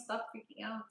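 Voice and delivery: ordinary talk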